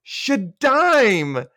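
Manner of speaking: high, loud proud call to Steve; proud announcing. Acted. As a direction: announce her name to the group, proudly. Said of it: "turns to the group and says".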